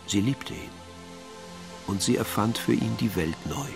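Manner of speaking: normal talking voice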